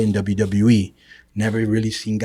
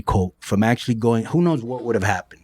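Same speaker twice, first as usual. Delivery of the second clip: normal talking voice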